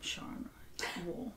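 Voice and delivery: unvoiced aside; whispered. Real, not acted. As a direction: whisper it to yourself.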